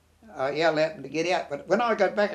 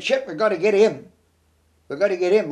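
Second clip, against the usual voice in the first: louder, higher, insistent retelling; urgent insistence; 3 semitones higher, 7 dB louder than usual. Retold or imitated. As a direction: press the rescue with a raised voice. Retold urgent decision to rescue mate.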